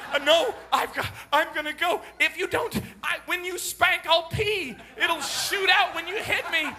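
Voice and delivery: put-on high, loud frantic kid voice; a stalling son begging for the bathroom; urgent child. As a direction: plead like a boy who must run. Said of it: "You know".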